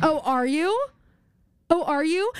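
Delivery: high, rising mock question; sarcastic retort. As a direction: challenge them with feigned surprise. Mock retort to presumptuous customers.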